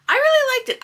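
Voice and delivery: really jumps high and loud on "really"; gushing emphasis. Real, not acted. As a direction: throw your whole voice into "really". Enthusing about the book.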